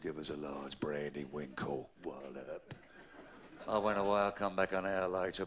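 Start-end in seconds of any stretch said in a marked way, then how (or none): 0.0-2.7 low gruff voice. Acted. Ordering brandy, voice put on.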